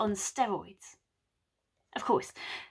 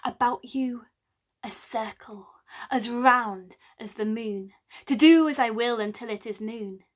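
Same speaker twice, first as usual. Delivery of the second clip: incantation. Acted. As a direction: cast a spell over sleepers. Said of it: "he spoke a spell over them".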